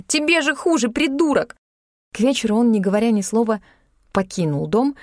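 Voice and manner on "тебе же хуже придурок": high-pitched angry outburst; barked insult. A narrator's reading. Heroine insults Dok as he won't leave, barking. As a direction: throw the insult at him, exasperated. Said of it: "рявкнула я".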